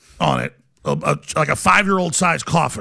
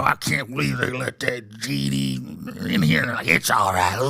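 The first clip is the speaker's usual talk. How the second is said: put-on low, slow croaky old man mumble; mumbled old-timer impression; 5 semitones lower, 5 dB quieter than usual. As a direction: grumble it out like doddering old men.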